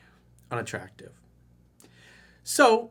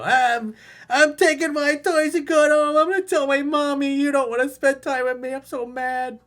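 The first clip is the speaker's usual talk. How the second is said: much higher bratty child whine, mock; whiny eight-year-old; 12 semitones higher, 8 dB louder than usual. Coach mocking client as a sulking child, high whiny. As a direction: sulk like a kid taking his toys home. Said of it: "It's like an eight-year-old".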